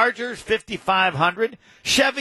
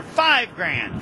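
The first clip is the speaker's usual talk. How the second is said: ordinary delivery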